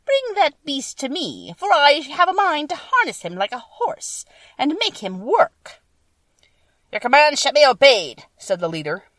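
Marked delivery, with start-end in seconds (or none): 0.0-5.8 high, loud, imperious witch; wicked witch voice. A narrator's reading. Wicked Witch planning to harness the lion, high loud command. 6.9-8.2 loud, high, declaiming creature leader; monkey leader's voice. A narrator's reading. Winged monkey leader reporting to the witch, loud high proclamation.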